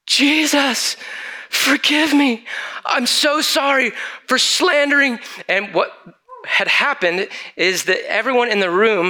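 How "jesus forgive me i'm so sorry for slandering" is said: crying out. Retold or imitated. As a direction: cry out to Jesus in high distress. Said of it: "started shouting".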